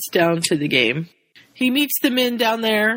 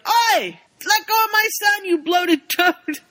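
high shouting protective father; angry dad; 13 semitones higher than usual. Retold or imitated. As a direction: yell at the coach to let go. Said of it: "George is like".

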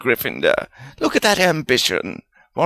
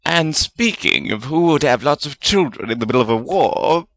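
excited, higher character voice; 8 semitones higher, 3 dB louder than usual. A narrator's reading. Wizard suddenly speaking up about a family, very excited. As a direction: burst in with lively, raised pitch. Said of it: "sounding very excited".

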